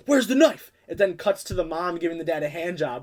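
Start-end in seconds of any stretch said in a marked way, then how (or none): 0.1-0.7 higher, louder sharp question; alarmed dad. Retold or imitated. Dad checking the dishwasher for the knife, alarmed. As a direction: demand it in alarm. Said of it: "He goes".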